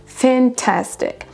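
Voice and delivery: "fantastic" slow, fan-TAS-tic, falling; second-syllable stress. Shown as a demonstration. Teacher reads the dictionary stress, "fantastic" stressed on TAS. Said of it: "l'accentuation se trouve sur la deuxième syllabe".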